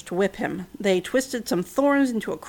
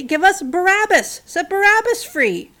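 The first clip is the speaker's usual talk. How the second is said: crowd shout; 7 semitones higher, 5 dB louder than usual. A narrator's reading. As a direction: chant it like a demanding mob. Crowd demands Barabbas.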